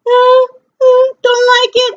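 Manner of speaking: high, sing-song squeamish refusal; mock scared whimper. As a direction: shrink away in playful fright. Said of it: "I'm like".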